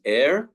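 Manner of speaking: "air" rhyming with "hair", R sounded; English pronunciation. Shown as a demonstration. Teacher comparing languages, "air" as English "ehr" with R. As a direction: say "air" as one syllable, "ehr". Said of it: "the English pronunciation of the same spelling".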